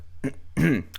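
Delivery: a deliberate, showy throat clear. Shown as a demonstration. Getting ready to introduce himself, clears his throat. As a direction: clear your throat before you start talking. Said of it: "clearing your throat".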